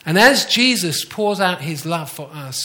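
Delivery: normal talking voice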